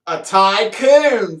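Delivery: slow drawn-out "A tycoon"; slowly savoured "A tycoon"; slow punchline. Shown as a demonstration. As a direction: stretch "tycoon" out to land the joke. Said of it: "Anybody wanna guess what this is".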